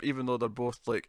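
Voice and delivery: ordinary delivery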